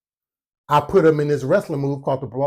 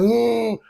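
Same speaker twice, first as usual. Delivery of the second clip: high strained grunt; mock grunt; 8 semitones higher than usual. Kid pushing against his hand, high mock grunt. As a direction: push out a high effortful hum.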